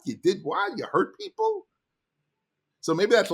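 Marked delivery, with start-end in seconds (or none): 0.1-1.7 climbing higher with each question, ending in a high cry; outraged daughter. Pretend. Imagined daughter confronting her dad over stolen work, rising disbelief. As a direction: let the disbelief build up into a high pitch.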